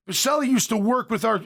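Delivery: usual speaking voice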